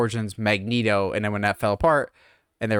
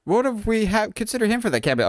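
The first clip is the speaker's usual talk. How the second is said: eager pitch; 8 semitones higher than usual. Acted. Acting out Feige pitching cameo ideas.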